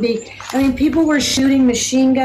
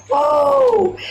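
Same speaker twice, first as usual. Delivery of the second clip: very high, drawn-out whoa; startled whoa; 13 semitones higher than usual. Retold or imitated. Missionary reacting to the Lord's answer, startled. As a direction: shoot the pitch way up in mock alarm.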